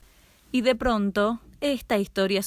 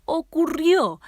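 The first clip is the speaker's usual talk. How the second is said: higher and louder dramatic cry; dramatic repeat; 9 semitones higher, 6 dB louder, slower than usual. A narrator's reading. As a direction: repeat the word ¡Ocurrió! much higher and louder, falling.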